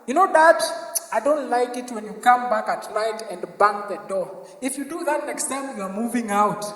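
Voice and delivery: low, soft, prim lecture; soft lecturing kid. Retold or imitated. Kid lecturing dad again.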